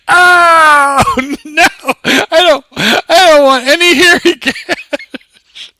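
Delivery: high, loud mock wail through laughter; horrified outburst. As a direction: wail the refusal, cracking up. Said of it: "I just remember going".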